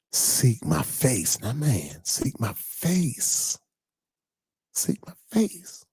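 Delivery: slow, high, stretched-out preaching; slow, rising sermon cadence; drawn-out preaching. Acted. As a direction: stretch each word like a plea from the pulpit.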